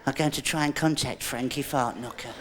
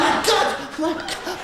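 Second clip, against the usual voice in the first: mock shock; 11 semitones higher than usual. Shocked at contact.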